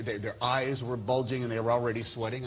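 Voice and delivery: ordinary delivery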